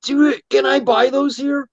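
high, slow mock wonder; amazed quote. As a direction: ask it like a kid in a candy store. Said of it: "Because I goes".

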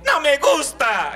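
high, slow whine; spoiled child. Retold or imitated. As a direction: pout it like a spoiled kid. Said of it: "El cachaquito sí dijo".

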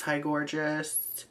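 Hi gorgeous stretched out slowly, mock flirty; slow drawn-out hi gorgeous. Real, not acted. Replying to a flirty follower, slow mock flirtation. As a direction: drawl hi gorgeous back teasingly.

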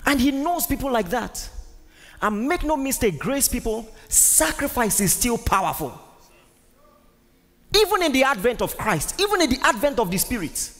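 high, forceful pulpit delivery; full-force preaching. Real, not acted. Preacher tells grace people sacrifice is still powerful, full-force preaching.

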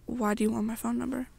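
ordinary delivery, nothing marked

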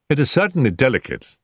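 lightly amused agreement. A narrator's reading. Holmes answers his worried client, amused. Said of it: "with an amused smile".

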